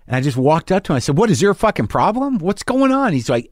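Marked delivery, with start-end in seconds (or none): 1.2-3.1 high, agitated demand; exasperated challenge in a raised pitch; retold angry confrontation. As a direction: re-enact confronting him, pitch shooting up. Acted hallway quarrel.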